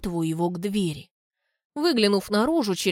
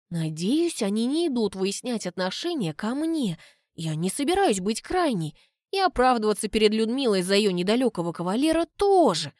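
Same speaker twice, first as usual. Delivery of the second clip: plain reading voice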